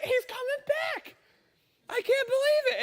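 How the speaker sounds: can't believe their eyes, voice leaping up; amazed disbelief. Retold or imitated. Soldiers amazed at Spears' return.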